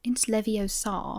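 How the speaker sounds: slow, prim posh correction; lightly voiced prim schoolgirl, slow; a hint of a posh know-it-all, drawn out; Hermione impression. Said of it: "talk like Hermione".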